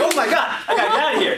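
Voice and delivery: high, loud panicked outburst, half laughing; panicked cry. Acted. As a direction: shriek it like a scared boy. Boy finds a dead body.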